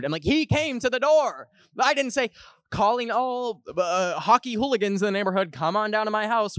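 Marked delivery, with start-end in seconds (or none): 0.2-2.3 high, defensive retort; indignant protest. Retold or imitated. Host defends self to mom about being sued, indignant. 2.7-6.6 high, sing-out invitation; mock announcement. Host mock-invites neighborhood hockey hooligans, calling out.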